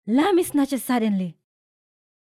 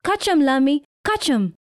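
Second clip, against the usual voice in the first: raised, higher call; shout; 5 semitones higher, 6 dB louder than usual. A narrator's reading. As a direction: call out across the yard. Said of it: "shouts Brother Bilal".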